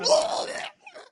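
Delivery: high, exaggerated shriek of laughter. Reacting as Rania runs out, high shriek. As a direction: burst out in a shrill cackle. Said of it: "I'm like".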